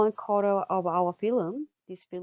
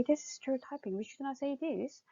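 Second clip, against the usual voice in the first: soft corrective remark; 6 semitones higher, 8 dB quieter than usual. Shown as a demonstration. Teacher models what to say.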